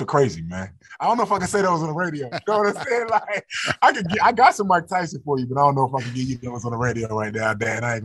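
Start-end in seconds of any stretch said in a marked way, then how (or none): 1.0-6.3 high, laughing through the words; giggling while dodging the request. Real, not acted. Athlete laughing, dodging the host's request for an impression.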